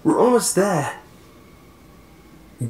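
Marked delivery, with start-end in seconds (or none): 0.0-1.0 high, raised-voice urging; pressing, high-pitched shout; urgent shout. A narrator's reading. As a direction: call it out to a friend on the run. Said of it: "shouted Jeremy".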